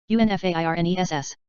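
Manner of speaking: quick letter run; brisk recap spelling; fast spelling. Shown as a demonstration. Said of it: "spelling drill, slow then fast".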